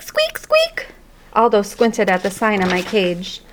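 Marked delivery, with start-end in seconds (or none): 0.0-0.9 tiny high squeaks, far up in the voice; hamster squeaks. Acted. Hamster squeaking at the janitor in tiny high squeaks. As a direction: squeak it like a small rodent. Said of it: "all that came out was squeak".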